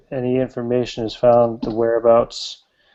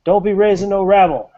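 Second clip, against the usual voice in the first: high, raised-voice scolding shout; sheriff shouting; 8 semitones higher, 5 dB louder than usual. Acted. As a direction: chase someone off like an angry lawman. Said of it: "shouting at her".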